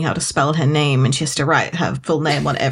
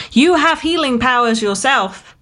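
exasperated scolding; 7 semitones higher than usual. Acted. Host scolds the book's heroine.

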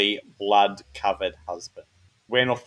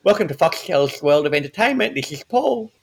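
put-on promo voice, jumping high on the name; mock TV announcer with a lisp; lightly voiced Foxtel promo; speech impediment voice; 5 semitones higher than usual. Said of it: "we used to go, like".

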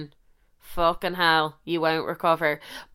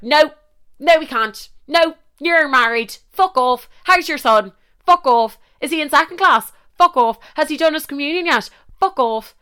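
louder, higher, snapping each fuck off; angry brush-off; 7 semitones higher, 5 dB louder than usual. Retold or imitated. Woman scornfully rejecting the married man with his son. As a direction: shut him down hard, question after question.

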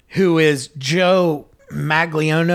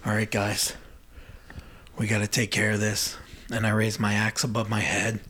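low, quiet, solemn address; hushed, grave resolve; super solemn; 5 semitones lower, 9 dB quieter than usual. Retold or imitated. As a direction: say it softly and gravely, pitched down. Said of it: "Super solemn".

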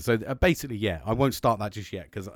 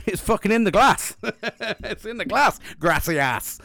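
laughing glee; 9 semitones higher, 5 dB louder than usual. Real, not acted. Gleeful about pouring gin.

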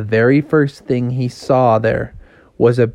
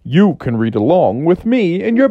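story intro; 6 semitones higher than usual. A narrator's reading. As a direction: announce the title to young listeners. Opens the read-along story.

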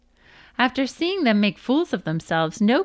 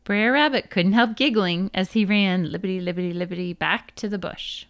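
plain reading voice

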